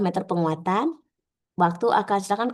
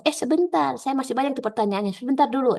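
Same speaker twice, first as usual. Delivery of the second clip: higher-pitched, hurried interjection; acted interrupting examiner; 6 semitones higher than usual. Examiner stopping a trainee who answers too soon, acted interruption. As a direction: cut in to hold off the answer.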